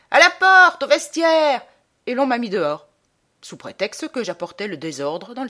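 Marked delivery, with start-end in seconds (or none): 0.1-1.6 high, very loud heckling cry; crowd's shout. Retold or imitated. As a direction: bellow it like an outraged theatre audience. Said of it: "Il a crié". (cri puissant du public en colère)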